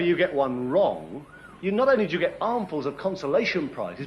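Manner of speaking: usual speaking voice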